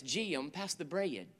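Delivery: Southern North Carolina accent, stretching "Jim" and "bread" into two syllables, slow and soft; North Carolina drawl. Shown as a demonstration. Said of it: "every word has two syllables".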